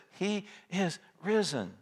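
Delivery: high, slow and softened, with reverent awe; glorious proclamation. Retold or imitated. Preacher repeating the angel's glorious report, hushed high proclamation. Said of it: "Could there be a more glorious report than this?".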